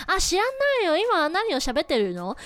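whining over the host who is talking; rude Japanese guests. Retold or imitated.

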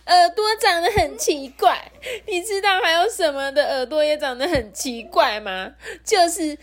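high wail, stretching the final words; crying. Acted. Rourou hears his ears are weird and wails. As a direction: bawl right through to the punchline. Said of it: "这个柔柔又哭了哦".

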